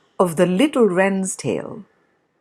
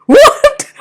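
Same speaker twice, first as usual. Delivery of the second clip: high, loud mocking laugh; bear laughing; 12 dB louder than usual. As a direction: burst into jeering laughter. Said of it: "he cried".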